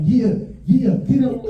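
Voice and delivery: high, loud burst of mock excitement; excited cheering on. Kid backing up his grandma against the dog, excited. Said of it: "I'm excited".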